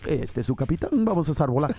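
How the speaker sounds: the same captain drone, in Spanish; Mexican captain voice. Retold or imitated. Comic imitating a Mexican captain's intercom, low voiced. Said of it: "they're like".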